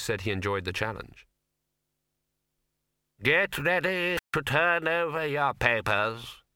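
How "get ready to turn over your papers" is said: slow, raised invigilator voice; exam proctor. A narrator's reading. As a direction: announce the start to the hall. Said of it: "said the invigilator".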